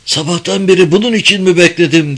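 angry. Acted. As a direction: snap it out in frustration. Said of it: "öfkeyle söylendi havaya".

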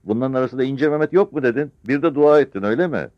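loud, high-pitched challenge; captain shouting accusing questions; shouted interrogation. A narrator's reading. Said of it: "diye bağırdı yüzbaşı". (bağırarak hesap soran)

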